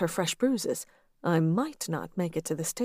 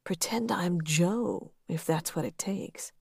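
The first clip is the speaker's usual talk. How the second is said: rasping whisper; 3 dB quieter than usual. Acted. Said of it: "his words rasped in my ear".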